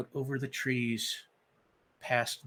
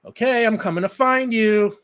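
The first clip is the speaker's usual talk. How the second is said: loud call into the woods, pitched up; higher, louder playful shout; yelling; 10 semitones higher, 12 dB louder than usual. Acted. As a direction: call out so she hears you. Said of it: "he yells".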